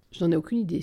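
plain reading voice

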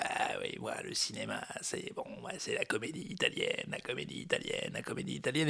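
soft, whispered snobbish film buff; mocking pundit. Mocking interview pundits praising Italian comedy, whispered. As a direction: murmur it like a smug cinephile. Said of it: "des gens dans les interviews qui disaient".

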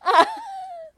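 loud, very high laugh. Real, not acted. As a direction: burst out in a high-pitched laugh. Sound after the song.

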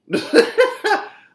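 sudden loud, high laugh. Real, not acted. Laughs mid-rant.

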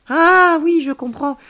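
high, loud drawn-out "Ah"; sudden realisation. A narrator's reading. Child grasping alien's warning, enlightened. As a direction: let the penny drop aloud.

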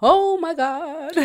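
high, long-drawn exasperated groan of words; mock exasperation. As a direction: stretch it out in mock despair. Her acted reaction to the messages.

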